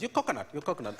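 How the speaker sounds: lightly voiced Sri Lankan mum, teasing; a hint of a Sri Lankan mother's accent. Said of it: "My mum sort of goes".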